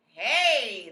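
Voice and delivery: loud startled cook's yell; a cook bellowing in alarm; sudden loud cry; shouted. A narrator's reading. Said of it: "the cook shouted".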